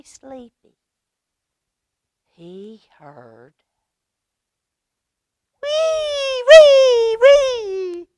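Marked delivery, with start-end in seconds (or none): 5.6-8.1 high loud wee wee cry; piercing high mouse cries; high squeal. A narrator's reading. Imitated alarm-like cry in story.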